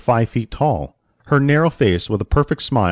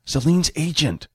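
higher-pitched, restrained hiss; lightly hissed character voice; hissed reply; 5 semitones higher than usual. A narrator's reading. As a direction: answer in a mild hiss. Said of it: "Jack hissed".

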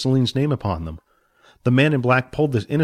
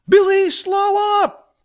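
loud, slow, high call; 5 dB louder, much slower than usual. Acted. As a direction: shout after him. Jack calls chasing.

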